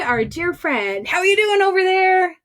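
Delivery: ordinary delivery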